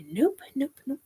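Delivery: retold whispered recoil; whispered refusal. Recalling a reaction to a nope rope, whispered. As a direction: whisper it, quick and unvoiced.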